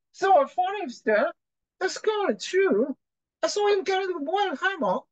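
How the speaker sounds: very high-pitched put-on Scottish accent; mock Scottish accent. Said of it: "Scotch accent is very hard to understand".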